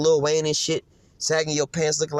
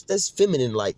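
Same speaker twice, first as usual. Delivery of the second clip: normal talking voice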